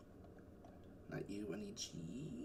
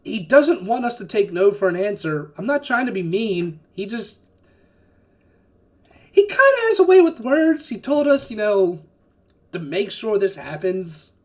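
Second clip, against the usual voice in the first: higher, louder in-character pitch; raised voice pleading his case; man outside; 5 semitones higher, 8 dB louder than usual. Acted. Man outside explaining his orders to the party, raised high voice. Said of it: "the man outside goes like".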